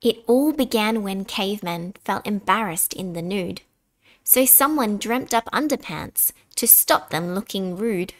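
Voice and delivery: ordinary delivery, nothing marked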